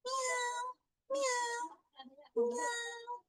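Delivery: very high, soft meowing; cat meow. Shown as a demonstration. Teacher meows softly as a cat for James. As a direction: mew like a small kitten.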